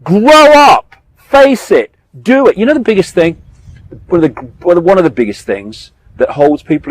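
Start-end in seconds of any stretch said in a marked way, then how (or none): 0.0-2.6 higher and louder command; raised, urgent demand; stern call. Real, not acted. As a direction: tell them straight to grow up. Exhorting people directly.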